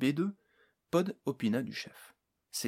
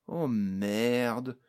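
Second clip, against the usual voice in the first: usual speaking voice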